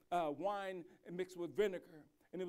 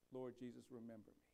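hushed prayer; 6 semitones lower, 10 dB quieter than usual. Retold or imitated. Quoting the thief's humble prayer.

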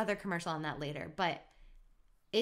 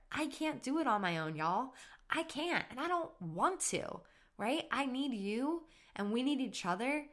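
getting emotional; 7 semitones higher than usual. Real, not acted. Telling listeners she can't do it alone, emotional. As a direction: voice lifting high with real feeling, close to tears. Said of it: "I'm getting emotional".

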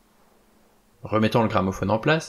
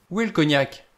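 higher, eager question; hurried concern; 6 semitones higher than usual. A narrator's reading. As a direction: ask for it promptly, pitch raised. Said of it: "s'empressa le docteur Armstrong".

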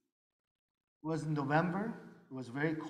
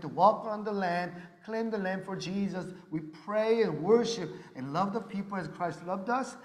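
louder, higher, driving every line home like a revival preacher; fervent preaching; 5 semitones higher, 6 dB louder than usual. Real, not acted. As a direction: preach it out to the whole hall. Preaching testimony at full force.

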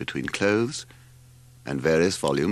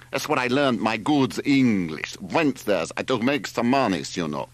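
put-on thick Greek-accented cabman, higher-pitched; Spiro; 5 semitones higher than usual. A narrator's reading. Driver introducing himself to the family, accented character voice.

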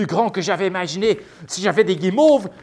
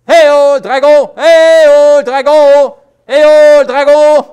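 loud, high hail across a distance; calling out; 7 semitones higher, 13 dB louder, slower than usual. Acted. Knight and children summoning the dragon, loud high hail. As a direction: holler for the dragon to come.

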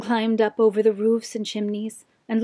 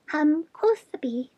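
bat voice; 5 semitones higher than usual. A narrator's reading. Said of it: "shrill voices".

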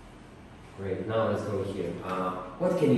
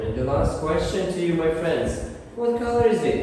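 excited; 6 semitones higher, 3 dB louder than usual. Real, not acted. Teacher excited final question.

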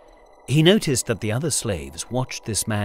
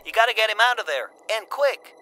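fast, high, urgent plea; 10 semitones higher, faster than usual. A narrator's reading. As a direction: hurry him along. Anne urging over comms.

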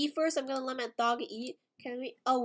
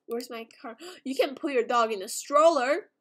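normal talking voice

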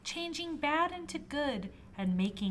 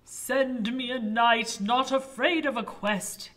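commanding king voice; 3 semitones higher, 7 dB louder than usual. Acted.

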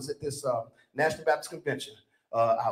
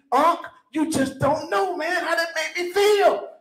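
slow, higher, drawn-out gloating; savouring nephew; 10 semitones higher, 3 dB louder, slower than usual. Retold or imitated. Nephew tells uncle again how the win felt, slow and higher. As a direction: stretch each word like reliving the glory.

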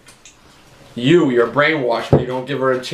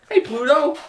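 high squeaky cartoon voice; 12 semitones higher than usual. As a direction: chirp it like a cartoon. Calling dog in cartoon voice.

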